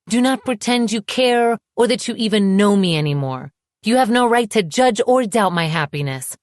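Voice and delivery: angry. A narrator's reading. Woman telling her ex he has no right to judge, angry. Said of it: "my anger not receding".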